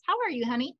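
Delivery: lightly imitated Texas cashier, sweet and rising; a hint of a friendly Texas drawl; Texas drawl greeting. Texas grocery clerk greets her, mild Texas sweetness. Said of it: "Which is what they do in Texas".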